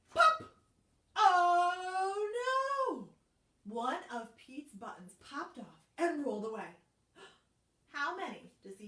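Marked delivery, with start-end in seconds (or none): 0.1-3.1 loud, high startled cry; pop. Acted. Teacher acting the button popping off. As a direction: burst out in alarm.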